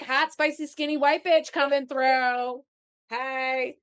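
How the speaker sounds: playful mock bravado; sassy strut. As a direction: strut it like you own the room. Retells confident self-talk, sassy.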